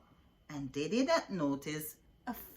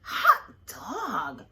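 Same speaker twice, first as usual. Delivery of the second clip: louder cheer but not high; happy cry; 4 semitones higher, 8 dB louder than usual. A narrator's reading. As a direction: cheer at the teacher's return. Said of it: "cried the kids".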